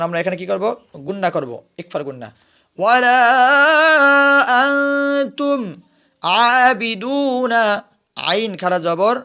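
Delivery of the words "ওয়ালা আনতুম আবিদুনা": slow chant, higher and louder, last word held long; Quranic recitation. Shown as a demonstration. Teacher reciting for the pupils, drawn-out chant. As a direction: hold the final word as a melodic note. Recites verse after spelling.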